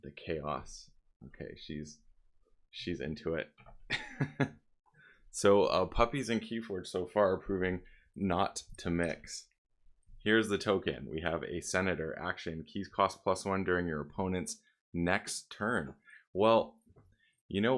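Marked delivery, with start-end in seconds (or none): none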